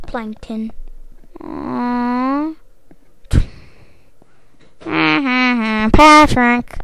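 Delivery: ordinary talk, nothing marked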